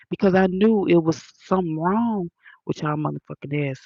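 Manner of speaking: ordinary talk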